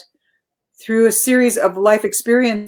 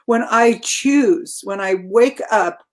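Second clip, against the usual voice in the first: ordinary delivery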